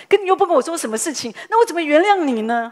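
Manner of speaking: how am I supposed to forgive this?; exasperated protest. Real, not acted. Pastor's wife tells the congregation about the vague apology, loud and exasperated.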